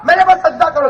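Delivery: high, ringing divine command; preacher's proclamation. Acted. Preacher voices God's command. (اونچی آواز میں حکمیہ پکار)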